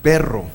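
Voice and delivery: "perro" with a firm popped P, rolled R. Shown as a demonstration. Teacher shows the dagesh turning the letter into P. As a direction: pop the P of "perro". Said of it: "el dagesh hace que suene como P".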